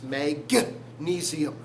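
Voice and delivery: hard G in magnesium, set apart; magnesium sounded out. Shown as a demonstration. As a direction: break magnesium slowly at a punched "guh". Contrasting it with manganese.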